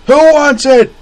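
very high loud call; hollering vendor. Acted. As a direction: shout it to the crowd.

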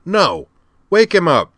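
high, loud, irritated order; demanding. A narrator's reading. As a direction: shout down the machine. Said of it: "Al demanded".

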